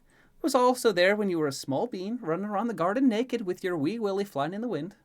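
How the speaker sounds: high, loud sing-song character voice; gleefully teasing Elaine. Acted. Elaine recalling him as a small bean, gleefully teasing. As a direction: rub it in merrily, swooping lilt.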